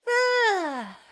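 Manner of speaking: loud drawn-out yawn. Real, not acted. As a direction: let a big yawn escape. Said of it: "now I'm yawning".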